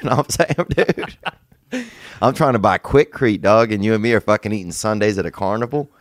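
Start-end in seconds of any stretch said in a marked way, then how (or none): 0.0-1.2 high, laughing through a bro quote. Retold or imitated. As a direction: crack up mid-rant.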